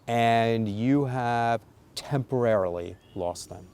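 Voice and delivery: slow, with temporarily leaned on; stress on temporarily. Shown as a demonstration. Parent telling child privileges are gone, temporarily stressed. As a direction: drag out the word temporarily. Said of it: "Emphasis on the word temporarily".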